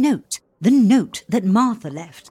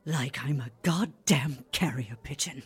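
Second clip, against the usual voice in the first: much softer, lower grumble; sulky under-the-breath complaint; bitter muttered aside; 9 semitones lower, 10 dB quieter than usual. Acted. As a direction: say it half to yourself, sour. Bitter aside after the note.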